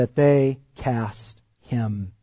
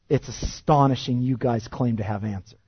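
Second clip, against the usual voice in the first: usual speaking voice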